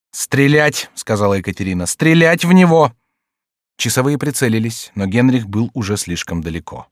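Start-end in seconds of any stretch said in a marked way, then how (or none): 0.1-0.9 raised, curt order; commanding. A narrator's reading. Catherine ordering the sentries, commanding. 1.9-2.9 high, forceful order; loud command. A narrator's reading. Catherine ordering fire on Henry, loud command.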